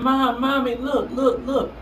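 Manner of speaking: put-on a small boy showing his cut, urgent; urgent child calling.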